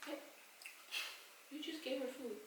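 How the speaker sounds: ordinary delivery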